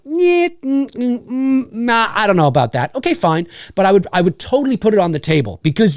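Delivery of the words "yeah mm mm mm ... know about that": high, doubtful hum, then a reluctant no; mock the studio exec turning it down; hesitant studio exec. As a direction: studio exec hedging, voice pitched up. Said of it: "the studio would be like".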